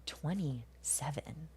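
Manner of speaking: Twenty-seven whispered slowly with a rising tune; Twenty-seven drawn out in a whisper, rising; disgusted whisper. Shown as a demonstration. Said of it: "just say the word twenty-seven".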